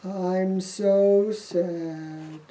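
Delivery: very slow, soft, low moan; mopey Thomas. Acted. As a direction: drag out the sadness in a sulk. Thomas moping.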